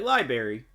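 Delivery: library as 'lie-berry'; own pronunciation. Shown as a demonstration. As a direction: drop the first r in library. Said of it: "Yeah, I say, like".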